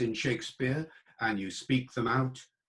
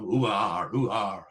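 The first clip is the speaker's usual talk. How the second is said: mock pirate "Ooh, ah" with a rolled R; pirate growl; 3 semitones lower, 3 dB louder, slower than usual. Lecturer imitating the pirate R youngsters recognise. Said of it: "They all recognize the R".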